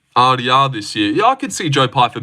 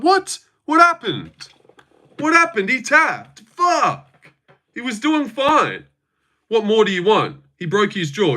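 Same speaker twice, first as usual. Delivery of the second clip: much higher, disbelieving cries of what happened; stunned disbelief; 9 semitones higher, slower than usual. Retold or imitated. Fan reliving Rob tapping, shocked high-pitched disbelief. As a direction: replay the shock of seeing Rob tap out. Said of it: "that was my reaction when Rob tapped".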